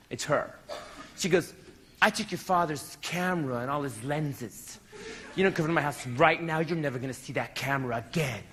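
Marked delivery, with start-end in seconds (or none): 2.0-8.4 low, deliberate ultimatum; lower, measured menace; retold threat. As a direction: imitate a girlfriend's cold phone threat. Girlfriend's retold phone threat.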